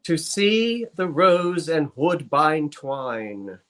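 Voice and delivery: very slow, drawn-out recitation; slowed cadence. Pretend. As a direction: linger on every word of the verse. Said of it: "Slow your cadence".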